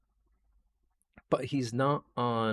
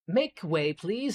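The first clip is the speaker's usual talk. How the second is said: high, raised gnome voice; high-pitched gnome; 9 semitones higher, 4 dB louder, slower than usual. Acted. Gnome ordering his shelves to move, high-pitched. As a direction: call it out high and commanding. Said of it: "this kind of high-pitch-ish voice".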